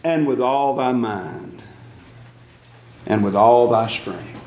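measured, solemn reading of the verse, each phrase slow; slow scripture recitation. Acted. Preacher to congregation, reciting the commandment slowly. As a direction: lay each phrase down slowly like holy writ.

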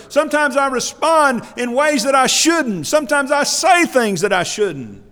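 high, loud impassioned preaching; fervent preacher's cry; preaching at full force. Real, not acted. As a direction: pour it out from the pulpit, loud and high.

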